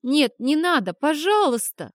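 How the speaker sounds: high, loud pleading cry; desperate begging. A narrator's reading. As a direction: beg desperately. Boy begs desperately.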